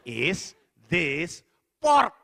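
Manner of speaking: very slow and loud, word by word; slow, over-careful repeat. Retold or imitated. Diner repeats himself to uncomprehending hotel staff, slow and loud. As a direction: spell it out with long gaps between words.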